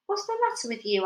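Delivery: high demanding question. Acted. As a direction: snap the question back. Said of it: "Aunt Sponge demanded".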